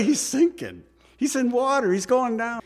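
high-pitched, incredulous urgency; mock alarm. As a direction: pitch it up as if he's drowning now. Comic urgency over Peter drowning.

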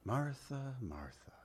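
soft, low, tender calling of a name; gently chiding, very quietly; gentle. Acted. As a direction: say the name twice, dropping to a hush. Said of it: "he said that was so gentle".

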